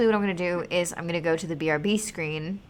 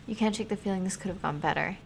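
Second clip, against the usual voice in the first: ordinary talk, nothing marked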